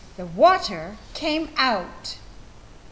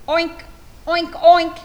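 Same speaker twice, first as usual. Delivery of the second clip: oinking pig; 5 semitones higher, 6 dB louder than usual. Acted. Pig sounds in picture book.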